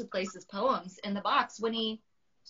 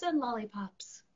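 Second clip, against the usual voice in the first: whispered; 2 semitones higher, 6 dB quieter than usual. Acted. Quiet reaction during announcement.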